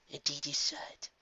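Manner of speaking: slow, hushed wise princess; soft Celestia voice. A narrator's reading. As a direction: speak softly like an old mentor. Celestia answers Twilight.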